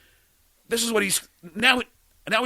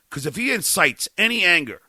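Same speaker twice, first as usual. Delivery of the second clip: ordinary talk, nothing marked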